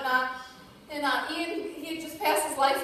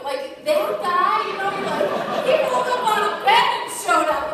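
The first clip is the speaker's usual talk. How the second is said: loud, high laughing tease; laughing disbelief; 5 semitones higher, 9 dB louder than usual. Real, not acted. Speaker telling her audience about an odd-looking earner, laughing. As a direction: laugh through the story.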